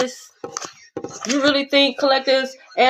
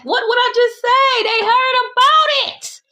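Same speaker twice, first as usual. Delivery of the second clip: mock shriek; 10 semitones higher than usual. Mock-excited shriek about love spell.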